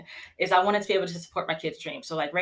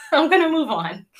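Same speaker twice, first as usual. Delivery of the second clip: "I'm gonna move on" through a bright nervous laugh, pitched higher and louder; 10 semitones higher, 8 dB louder than usual. Real, not acted. Brushing off her emotion for the viewers, laughing and louder. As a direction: laugh off the tears and push forward.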